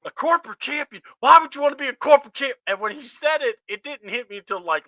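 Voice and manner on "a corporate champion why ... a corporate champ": high, loud mimicry of Seth; mock Seth's outraged voice, pitched up; imitating an indignant wrestler. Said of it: "he's like".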